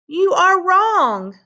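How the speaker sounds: high, loud, slow insistence; arguing boy. Acted. Tim arguing with Nabai over the coat, insistent. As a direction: dig in and contradict your friend.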